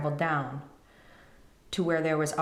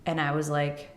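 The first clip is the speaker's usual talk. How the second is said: ordinary delivery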